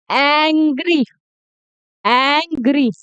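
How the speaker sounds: एंग्री with a drawn-out open 'ae' as in "cat"; big A sound. Shown as a demonstration. Teacher models the fourth sound for learners, big A sound. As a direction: stretch the first vowel of एंग्री to a wide 'ae', then 'gree'. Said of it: "ये बड़ा ए है".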